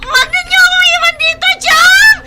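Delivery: high, loud wailing plea; girl shrieking and crying for her aunt not to leave; screaming sobs. Acted. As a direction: scream after her through tears.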